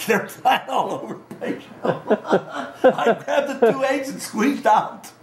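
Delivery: high, laughing storytelling; laughing while telling. Real, not acted. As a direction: crack up recalling your own mischief. Laughing at own chicken coop story.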